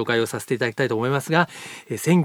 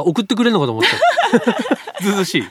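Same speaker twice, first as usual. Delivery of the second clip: laughing; 7 semitones higher than usual. Real, not acted. Joking disappointment, laughing.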